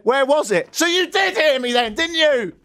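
high-pitched mock indignation; exasperated retort. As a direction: jump up high, accusing in jest. Acted exchange with the exasperated man.

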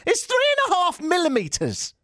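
very high mock shriek; exasperated scream. Host mocking Apple's claim of freed-up space, exasperated scream. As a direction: shriek it in comic disbelief.

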